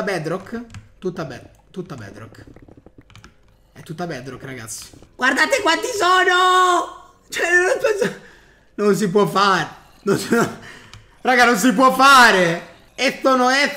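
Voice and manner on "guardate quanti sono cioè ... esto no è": high, loud laughing panic; panicked shout. Real, not acted. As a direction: yell in laughing alarm. Sees endless mobs.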